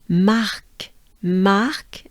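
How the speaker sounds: marque with a breathed R, then a crisp k; French R plus K. Shown as a demonstration. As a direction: model marque in French, each take separate. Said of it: "tricky to add consonants with the R".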